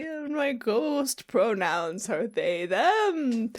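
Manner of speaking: high, drawn-out spooky ghost voice; wailing ghost. Acted. Ghost host introducing a podcast to listeners, spooky wail. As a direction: moan the words out slowly like a ghost.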